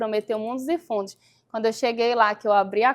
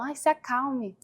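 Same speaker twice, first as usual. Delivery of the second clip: mocking calm-down; 3 semitones higher, 4 dB quieter, slower than usual. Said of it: "eles falam".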